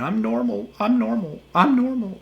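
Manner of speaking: high, whiny mock sobbing; mock crying. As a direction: imitate someone crying that they are normal. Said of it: "this person was crying and saying".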